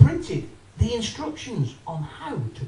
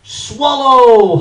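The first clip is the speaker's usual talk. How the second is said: loud; 3 semitones higher, 10 dB louder than usual. Acted. First reading of label.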